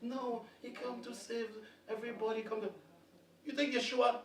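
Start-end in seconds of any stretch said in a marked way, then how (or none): none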